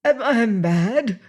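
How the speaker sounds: a stunned prisoner fearing madness; dazed dread. A narrator's reading. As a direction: ask it as if doubting your sanity. Wildly scanning the cabin.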